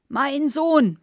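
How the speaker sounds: put-on loud, high drunken roar; bellowing. A narrator's reading. Drunk Gustav storming toward the kitchen: loud bellow. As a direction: roar the words out. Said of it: "brüllte Gustav".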